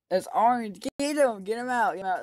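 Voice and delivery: high, loud shouts to the group; urgent yelling. Real, not acted. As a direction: shout them into voting him out. Urging others to vote orange.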